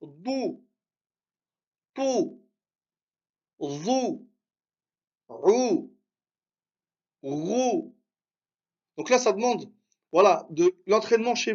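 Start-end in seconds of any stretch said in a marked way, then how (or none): 0.0-8.0 each syllable said alone and held, ou, bou, tou; teacher calling out syllables one by one; syllable drill. Shown as a demonstration. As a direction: model each sound for students to repeat. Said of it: "Un peu plus grave".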